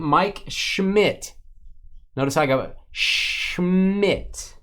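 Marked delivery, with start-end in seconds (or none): 0.5-1.3 Schmidt pitched high; emphasis on Schmidt. Real, not acted. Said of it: "A little emphasis on it". 2.9-4.6 Schmidt stretched slow and high; high, elongated Schmidt for emphasis. Shown as a demonstration. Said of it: "A little emphasis on it".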